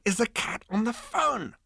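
high, slow drawn-out question; brash puzzlement. Acted. Zaphod wonders who is calling, drawn-out puzzlement.

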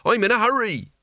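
high, urgent mild shout; acted yell of impatience; impatient shout. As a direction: call out, desperate to get going. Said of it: "shouted Mort, totally unheard".

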